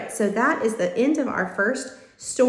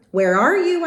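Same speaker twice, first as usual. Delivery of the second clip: loud, higher booming shout; booming call; 7 semitones higher, 7 dB louder than usual. A narrator's reading. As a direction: call out through the whole house. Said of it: "My father's voice was booming".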